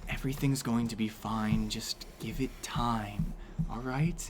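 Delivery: an elf soothing his lover, softly and quietly; hushed comfort. Acted. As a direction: murmur it close to her ear. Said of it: "and he's just like".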